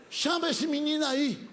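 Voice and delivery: calling out like a toothless preacher. Retold or imitated. Pregador banguelo chamando. (pregador banguelo chamando)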